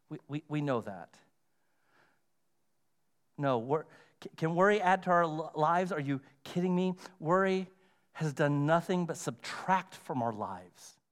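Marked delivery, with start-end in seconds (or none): none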